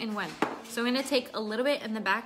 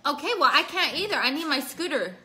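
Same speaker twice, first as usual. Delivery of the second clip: higher and louder reply; calling back; 5 semitones higher, 7 dB louder than usual. Real, not acted. Answering her screaming son, loud call back.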